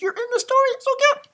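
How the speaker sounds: very high coo; very high cooing squeal. Acted. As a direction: squeal over how cute it is. Cooing over story.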